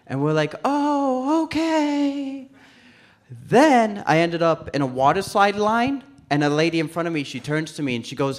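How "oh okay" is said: high, drawn-out sing-song agreement; mock compliance. Stoned pals answer a slide attendant, mock compliance. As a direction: draw out a high, spaced-out okay.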